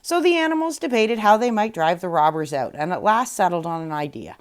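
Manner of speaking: plain reading voice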